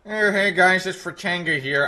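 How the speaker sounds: half-hearted impression of another streamer; Fratanga. Streamer attempting a requested impression, half-hearted put-on voice. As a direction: try a quick voice impression, then give up. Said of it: "Talk like Fratanga".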